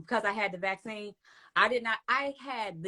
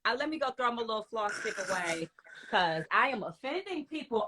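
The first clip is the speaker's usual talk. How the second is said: usual speaking voice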